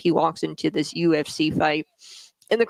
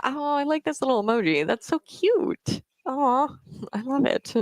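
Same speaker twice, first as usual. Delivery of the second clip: cooing; 8 semitones higher than usual. Real, not acted. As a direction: melt over a cute cat emoji. Cooing at a viewer's cat emoji.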